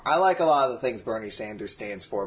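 normal talking voice

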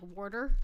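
"warder" with an r-colored "wor" for water; New York-style water. Retold or imitated. Imitating the actor's water, "warder" with an "or" sound. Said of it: "type of sound when he says water".

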